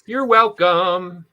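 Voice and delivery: loud, drawn-out, sing-song showman; grandly self-satisfied, stretched-out quote; Dwayne "The Rock" Johnson in Moana. Acted. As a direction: belt it out high and slow, half sung. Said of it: "Dwayne "The Rock" Johnson said in Moana".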